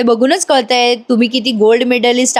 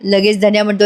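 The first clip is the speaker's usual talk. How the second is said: usual speaking voice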